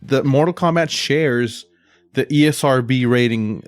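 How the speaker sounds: ordinary delivery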